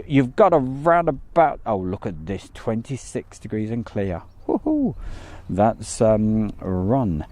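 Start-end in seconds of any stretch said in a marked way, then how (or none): 4.5-4.9 excited whoop. Real, not acted. Delighted at the warm weather.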